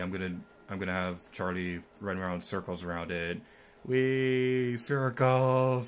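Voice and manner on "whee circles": higher, held-out cheer; mock-gleeful singsong cheer; playful mock glee. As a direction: whoop like a kid on a merry-go-round. Playful cheering while running in circles.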